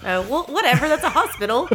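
laughing through the words; sheepish laugh while correcting; amused, brushing it off. Real, not acted. Laughing at own mistake.

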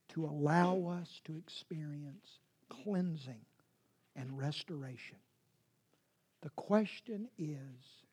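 soft, low, near-whispered sermon; hushed preaching. Real, not acted. Preacher hushes to the congregation about confession, softly. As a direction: draw the congregation in quietly.

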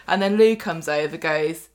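usual speaking voice

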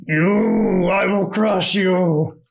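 slow, booming barbarian threat. Acted. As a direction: growl it out like a hulking brute. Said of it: "spot-on impersonation of him".

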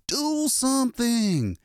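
high, drawn-out desperate cry; panicked high yell; frantic scream. A narrator's reading. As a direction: scream it slowly at people who won't act. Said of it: "he screamed".

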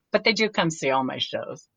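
usual speaking voice

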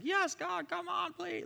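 high-pitched, mock over-the-top praying; frantic prayer. Teacher mimicking someone praying wildly, mock frantic prayer. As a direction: imitate someone going crazy in prayer. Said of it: "if they're gonna pray in tongues or go crazy".

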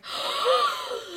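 long, high mock gasp; shocked gasp. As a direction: suck in air in exaggerated shock. Imitates shocked reaction to ages.

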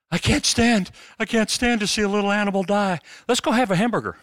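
high-pitched mock squeamish complaint; mock squeamish meat-eater. Mimicking squeamish burger eaters.

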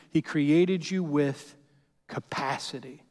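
slow, with a pause before capacity; slow build. Real, not acted. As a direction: drag out "He created you with" then land "capacity". Preacher stresses key word.

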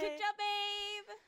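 loud, slow, high cheer. Real, not acted. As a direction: cheer proudly for her. Cheering girlfriend's third place.

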